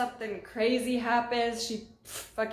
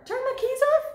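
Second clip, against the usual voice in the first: incredulous self-check; 5 dB louder, faster than usual. Real, not acted. Correcting herself, incredulous.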